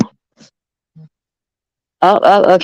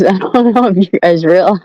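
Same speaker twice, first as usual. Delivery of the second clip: normal talking voice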